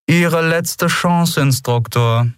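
high, measured voice of a commander; a hint of a clipped officer; cold interrogator. A narrator's reading. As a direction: speak down to the prisoner. Interrogator's threat.

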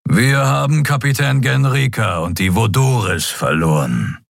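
sombre creature voice; serious Skria. A narrator's reading. Skria captain reporting losses to the admiral. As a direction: report the loss gravely. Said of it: "Sron wurde ernster".

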